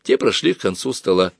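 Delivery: ordinary delivery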